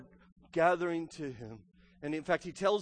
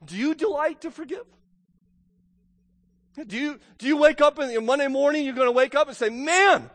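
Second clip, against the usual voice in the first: fervent pulpit voice, louder and punched; preaching at full force; 9 semitones higher, 8 dB louder than usual. Acted. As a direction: hammer home each bad people.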